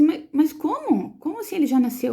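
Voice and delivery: mais aguda, devagar, chocada; espanto aflito. Retold or imitated. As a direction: reaja em choque à notícia. Mãe em choque com a notícia. (mãe chocada ao saber que o filho já nasceu)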